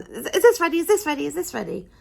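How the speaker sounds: high, pushy repeated question, fading; mock the pushy queue-jumpers; impatient customers.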